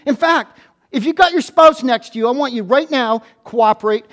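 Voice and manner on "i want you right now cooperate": ordinary talk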